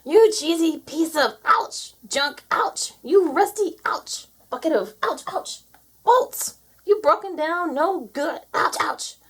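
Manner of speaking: high-pitched rant broken by yelps; exasperated scolding. Acted. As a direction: curse the machine between pained ouches. Cursing the tractor while being pecked.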